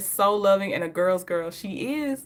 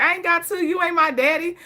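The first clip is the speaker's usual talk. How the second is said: mock sassy retort; 7 semitones higher than usual. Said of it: "Queen Sheba got that intro".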